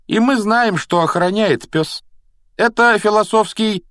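high, emphatic boasting; self-important voice. A narrator's reading. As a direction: reveal a secret loudly and proudly. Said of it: "важным голосом произнёс Рон".

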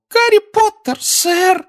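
very high squeaky house-elf. Acted. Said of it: "раздался писклявый голос".

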